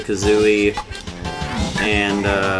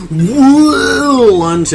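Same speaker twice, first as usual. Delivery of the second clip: loud drawn-out lunge yell; 9 dB louder than usual. Pretend. Voicing the lunge.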